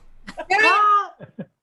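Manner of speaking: high, loud burst of laughter. Real, not acted. Host reacting to the player's camera mishap, loud high laugh.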